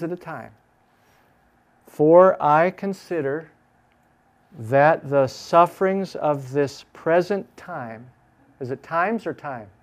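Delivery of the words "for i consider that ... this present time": slow, emphatic, word by word; dictating while writing. Shown as a demonstration. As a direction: spell the verse out slowly and firmly. Saying the verse while writing it.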